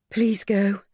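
weak, pleading woman in labour; imploring. Acted. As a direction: beg her to leave, in pain. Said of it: "Lily implored".

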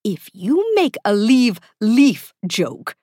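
slow, exasperated warning; drawn-out, fed-up threat. A narrator's reading. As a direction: warn off a bad joke, slowly. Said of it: "Kaz warned".

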